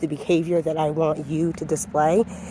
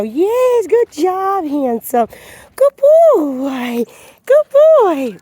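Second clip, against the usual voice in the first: much higher, louder squealing praise; over-excited dog praise; 12 semitones higher, 6 dB louder, much slower than usual. Shown as a demonstration. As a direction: rev the dog up, pitch climbing to a peak. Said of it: "see the difference in his personality?".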